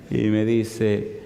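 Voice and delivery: ordinary talk, nothing marked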